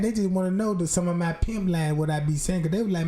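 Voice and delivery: ordinary talk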